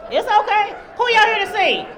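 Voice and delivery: loud, high-pitched call to the audience; rally the room into a big cheer; hyping the crowd. Real, not acted.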